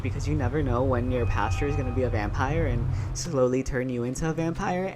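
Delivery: normal talking voice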